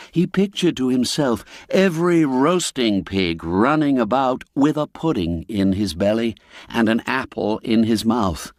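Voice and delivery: ordinary delivery, nothing marked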